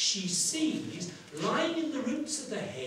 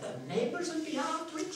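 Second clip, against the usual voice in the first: put-on high old countrywoman voice; old woman; 8 semitones higher, 3 dB quieter than usual. Washerwoman imagines neighbours after her gold, high old-woman voice. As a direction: confide it in a raised old-woman voice. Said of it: "how old and worn out she is".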